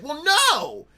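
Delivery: high, loud bratty "no"; kid's defiant shout. Retold or imitated. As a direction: yell it like a defiant small child. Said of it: "I'd be like".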